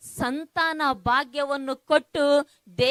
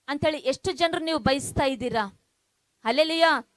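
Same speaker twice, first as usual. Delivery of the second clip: ordinary delivery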